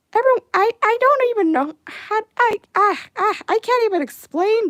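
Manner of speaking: exasperated outburst. Real, not acted. Exasperated about Johnny Test.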